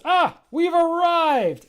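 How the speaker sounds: Charda arriving. Acted. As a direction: proclaim the arrival like a pompous host. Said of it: "And then he goes".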